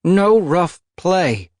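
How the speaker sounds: high, loud, slow warning to the kits; scolding mother. A narrator's reading. Mother cat chasing her kits, scolding call. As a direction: call after the kits firmly. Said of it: "their mother hurried after them".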